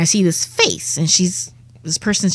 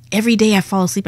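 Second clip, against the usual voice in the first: usual speaking voice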